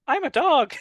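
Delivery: put-on very high, childlike Ralph voice; quoting Ralph proud to be a puppy; mock Ralph. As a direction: squeak it like a happy little kid.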